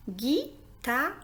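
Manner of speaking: "Guitare" in three beats: gui, ta, re; split into syllables. Shown as a demonstration. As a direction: pause between each syllable of "Guitare", final re sounded. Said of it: "composé de trois syllabes". (« Guitare » découpé : gui, ta, re)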